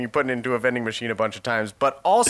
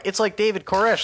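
slightly raised voice; 8 semitones higher than usual. Retold or imitated. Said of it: "and then we go".

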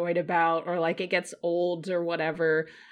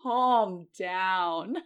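very slow drawn-out eye-roll; dismissive; 2 semitones lower, much slower than usual. Retold or imitated. Host brushing off an over-the-top commenter, drawn out. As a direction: stretch it out, unimpressed. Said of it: "And I'm like".

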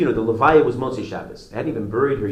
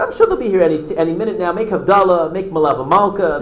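higher, louder calm reassurance; reassuring wife; 7 semitones higher, 6 dB louder than usual. Retold or imitated. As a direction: brush him off gently, hide the news. Wife soothes husband, hiding death.

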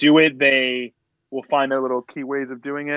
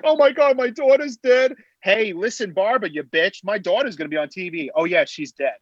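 put-on Long Island accent; 6 semitones higher than usual. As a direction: shriek it like a Long Island mom. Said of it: "did the whole Long Island angle".

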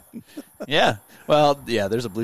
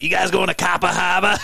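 higher-pitched, heavy Boston accent imitation; Doug Simpson; 7 semitones higher, 3 dB louder than usual. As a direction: drop the r's like a caricatured Bostonian. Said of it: "has a heavy Boston accent".

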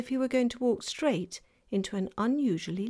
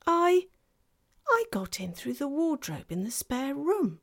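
put-on girl's voice; 7 semitones higher than usual. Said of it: "said Lucy".

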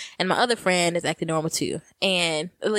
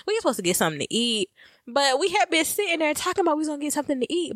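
ordinary talk, nothing marked

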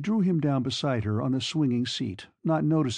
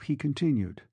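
plain reading voice

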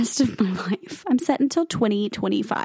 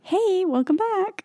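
much higher, slow, sing-song hey welcome back; mock cheery greeting; 11 semitones higher, slower than usual. Cohost imitating their old podcast intros, high and sing-song. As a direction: chirp it like a clueless host. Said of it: "we were just like".

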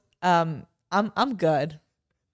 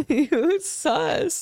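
laughing; 7 semitones higher than usual. Real, not acted. Teasing while laughing.

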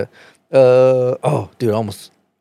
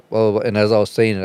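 usual speaking voice

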